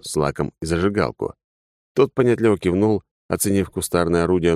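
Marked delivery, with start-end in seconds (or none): none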